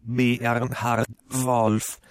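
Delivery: backwards speech played in reverse, slow; slow, warped reversed audio; reversed playback. Shown as a demonstration. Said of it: "Wir hören uns jetzt mal an".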